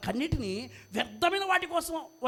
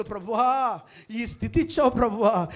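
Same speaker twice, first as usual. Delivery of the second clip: acted tearful plea; 7 dB louder than usual. Preacher voices a believer at Jesus's feet, imploring prayer. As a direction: call out as if weeping at the Lord's feet. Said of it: "అని ప్రభు పాదాలు పట్టుకొని ఏడిస్తే".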